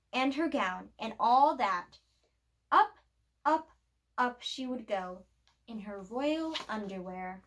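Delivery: ordinary delivery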